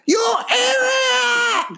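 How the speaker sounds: high, slow, shouted mock accent; hammed-up accented general yelling at his men; mock Chinese general. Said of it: "the general comes out and looks at the others and goes".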